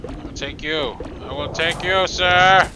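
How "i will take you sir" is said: high, loud playful threat; playfully belting out the threat; mock menacing. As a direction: build to a loud, high SIR. Repeats playful threat, building up.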